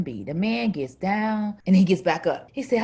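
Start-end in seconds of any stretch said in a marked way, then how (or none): none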